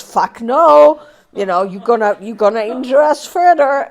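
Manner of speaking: the body yelling back no; defiant body. Retold or imitated. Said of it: "and the body goes".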